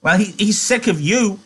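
high-pitched taunting rabbit; high, scornful character jab. A narrator's reading.

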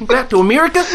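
a high, nasal mock Kiwi question; hammed-up Kiwi accent. Shown as a demonstration. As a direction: push the Kiwi accent high with a rising end. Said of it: "you need to be a little more nasally".

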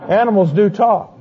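ordinary talk

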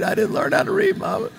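put-on high, breathless wail of a crying little boy; mock sobbing child. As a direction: let the sob break through the words.

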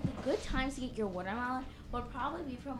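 usual speaking voice

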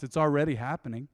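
ordinary talk

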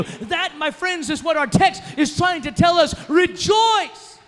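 loud, rising preacher's climax, pressing every line; rally the church like a preacher at full cry; full-force preaching. Acted. Pastor rallying the congregation against the devil, loud full-force preaching.

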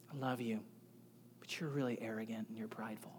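soft, quick, near-whispered correction; hushed loving words from a father figure; hushed loving rebuke. Retold or imitated. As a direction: lay the hard truth down gently and quietly. Pastor's gentle rebuke retold.

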